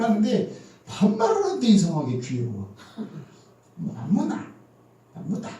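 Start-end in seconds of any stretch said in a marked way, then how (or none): 3.8-5.5 Gyeongsang dialect. Retold or imitated. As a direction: mimic a Gyeongsang daughter asking her mom. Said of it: "어떤 아가씨가 자기 엄마한테 얘기하는데 반말을". (경상도 사투리로 귀엽게 반말하는 딸 흉내)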